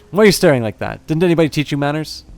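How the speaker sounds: rapid-fire reproach. A narrator's reading. Orc scolds a staring stranger, rapid-fire reproach. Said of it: "fires off a rapid-fire reproach".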